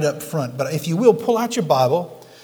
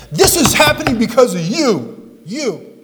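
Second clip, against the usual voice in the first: higher, louder pointed blame; blaming accusation; 8 semitones higher, 5 dB louder, slower than usual. Retold or imitated. Pastor mimicking people who blame others, higher and louder accusing. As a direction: jab the finger at someone. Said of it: "many folks will blame somebody else".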